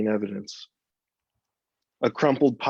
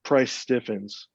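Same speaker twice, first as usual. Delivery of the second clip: plain reading voice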